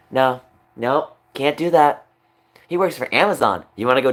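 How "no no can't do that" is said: ordinary talk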